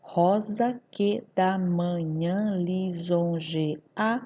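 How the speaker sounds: slow, syllable-by-syllable scansion; scanning the verse. Shown as a demonstration. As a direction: chop the line into separate, drawn-out syllables. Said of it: "vamos fazer a escansão". (escansão lenta, sílaba por sílaba)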